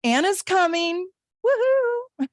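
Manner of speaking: high whooping delight; excited cheer. Real, not acted. Host celebrates a viewer coming to her retreat, whooping. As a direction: cheer like a fan at the news.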